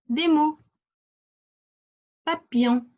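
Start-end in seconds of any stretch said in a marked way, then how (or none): none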